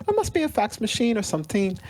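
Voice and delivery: put-on Bajan accent grandma. Grandson quoting his Barbadian grandma about the box, in a high Bajan accent. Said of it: "so I have this Bayesian accent".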